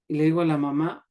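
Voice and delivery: usual speaking voice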